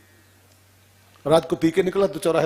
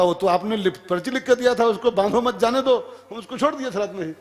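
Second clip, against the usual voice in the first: higher-pitched acted protest; pleading justification in a raised pitch; defending himself; 7 semitones higher than usual. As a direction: explain yourself to the boss, ending in a rush. Guard defending himself to chief.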